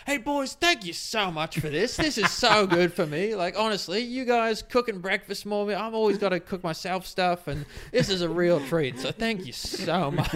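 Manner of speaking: high, slow, effusive calling out; Adrian shouting out. Retold or imitated. Customer calling over counter to cooks, mock shouting out. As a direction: imitate an overfamiliar customer hollering gratitude. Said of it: "now shouting out to them".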